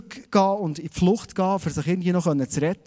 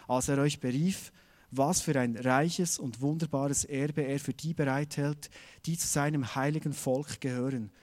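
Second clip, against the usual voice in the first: lower, softer solemn reading voice; scripture reading; 3 semitones lower, 4 dB quieter than usual. Acted. Preacher reading Ephesians to the congregation, solemn and hushed. Reads Bible verse aloud.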